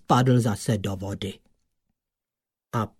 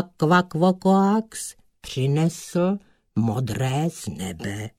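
plain reading voice

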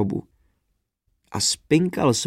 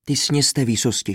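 plain reading voice

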